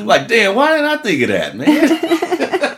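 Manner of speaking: high-pitched, laughing through the words; real amused laughter, pitch way up. Real, not acted. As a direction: crack up while saying it. Laughing reaction to the meme joke.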